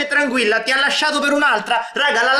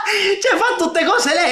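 laughing; 8 semitones higher, slower than usual. Real, not acted. Laughing at the girl's rant.

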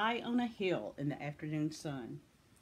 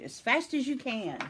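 higher, urgent urging; urgent cry; 6 semitones higher than usual. A narrator's reading. Grandude hurries children from the buffalo, crying out. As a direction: spur them on urgently. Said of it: "cried Grandude".

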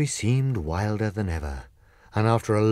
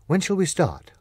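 lightly voiced Scarecrow; 9 semitones higher than usual. Scarecrow asks.

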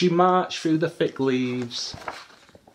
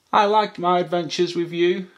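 high, cheerful little voice; baby elephant; 6 semitones higher, 4 dB louder than usual. A narrator's reading. Baby elephant to mum at bedtime, high and happy. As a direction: tell mum fondly, in a youngster's voice. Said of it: "said the baby elephant".

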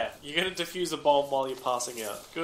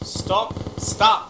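sharp, higher, louder stop call; urgent shout; 7 semitones higher, 4 dB louder than usual. Real, not acted. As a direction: yell stop to halt the squad. Urgent halt call in firefight.

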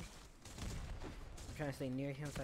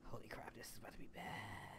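whispering; 4 dB quieter than usual. Real, not acted. Mutters dread about the game.

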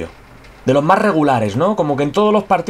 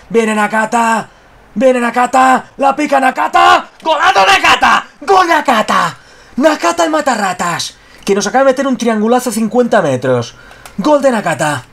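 goal commentary shout; 8 semitones higher, 4 dB louder than usual. Real, not acted.

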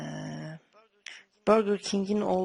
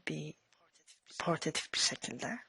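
whispering; 2 semitones lower, 7 dB quieter than usual. Acted. Suggestive aside.

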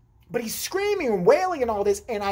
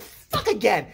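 high, loud whining complaint; whiny kid; 3 dB louder than usual. Retold or imitated. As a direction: whine like a fed-up kid. Annoyed kid at lost brother.

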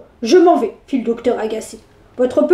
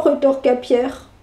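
plain reading voice

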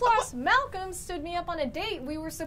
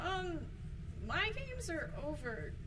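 deflated; 2 semitones lower, 10 dB quieter than usual. Acted. Tracy caught, prank is over.

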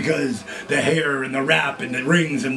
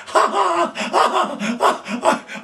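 high, cartoonish mock cackle, much higher; imitating a huge laugh; much slower than usual. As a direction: ham up a friend's helpless belly laugh. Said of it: "Hayes started laughing so much".

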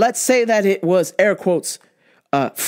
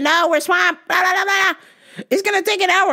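mock staff voice; 10 semitones higher than usual. Voicing restaurant staff.

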